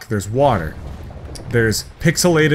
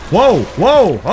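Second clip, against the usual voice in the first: startled whoa; 5 semitones higher, 4 dB louder than usual. Real, not acted. Startled by cops transforming.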